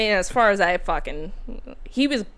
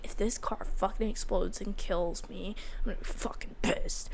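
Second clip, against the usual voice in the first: whispered; 9 dB quieter than usual. Retold or imitated. Inner thought near crashed car.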